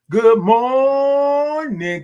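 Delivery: Good morning held long, high and loud; signature greeting. Acted. Host opens his live stream with a big drawn-out greeting.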